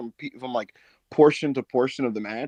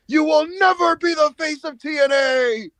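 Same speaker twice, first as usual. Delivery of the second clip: high, slow, loud hammed-up taunt; mock villain taunt; 13 semitones higher, 5 dB louder, slower than usual. Alexander taunting Santana, in a high slow hammed-up taunt. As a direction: sneer it slowly like a heel. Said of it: "Alexander's like".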